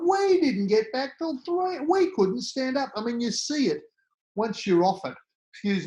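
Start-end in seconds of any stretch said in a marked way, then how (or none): 0.1-2.9 hammed-up mate showing off about the night out; mock boastful drinker. Boasting about a big drunken night.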